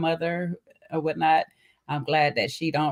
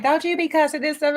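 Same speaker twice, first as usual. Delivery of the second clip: high mock telling-off; mother cussing out her grown child, high mock scold; 12 semitones higher than usual. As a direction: imitate mom's scolding, pitched up. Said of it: "she cussing me the heck out".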